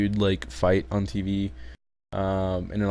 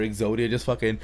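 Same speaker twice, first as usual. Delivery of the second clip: ordinary talk, nothing marked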